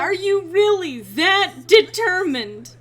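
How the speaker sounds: slow, loud incredulous question. Real, not acted. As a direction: stretch out the disbelief. Disbelief at repeated donation.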